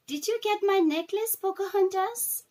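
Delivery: put-on high, eager child's voice; young Alava eagerly asking Pocahontas about her necklace, high and hopeful; little girl voice. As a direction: ask hopefully like a small girl.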